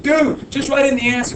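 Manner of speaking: high mock impatient student voice. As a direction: play an eager kid begging him to hurry.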